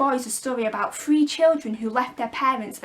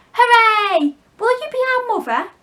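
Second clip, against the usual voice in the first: high, loud cheering; joyful shout; 11 semitones higher, 8 dB louder than usual. Acted. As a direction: boys cheering in delight. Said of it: "they shouted".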